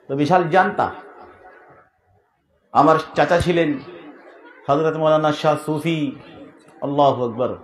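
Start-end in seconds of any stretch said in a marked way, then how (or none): none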